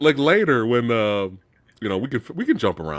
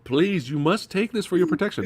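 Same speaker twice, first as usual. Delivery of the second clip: high-pitched mock pleading; village woman imitation; 6 semitones higher, 4 dB quieter than usual. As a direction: plead earnestly in a put-on high voice. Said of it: "she's trying to give him the cross".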